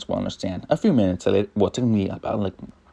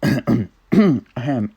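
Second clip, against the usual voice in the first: sage voice; 4 semitones higher, 5 dB louder than usual. Acted. Sage voice clears throat.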